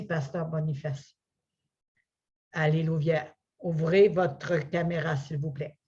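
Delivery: usual speaking voice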